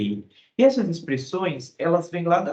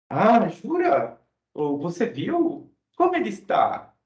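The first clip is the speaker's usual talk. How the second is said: higher, slow, amazed "jura"; surprised interest; 6 semitones higher than usual. Shown as a demonstration. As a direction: sound curious and surprised. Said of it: "expressando surpresa e interesse". (surpresa e interesse, voz mais aguda)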